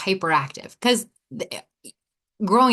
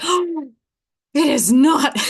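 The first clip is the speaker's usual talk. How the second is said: much higher, louder indignant gasp; shocked denial; 7 semitones higher, 6 dB louder, slower than usual. Retold or imitated. Wife denies it to her husband, shocked and loud. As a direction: act scandalized at the idea. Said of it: "And I was like, gasps".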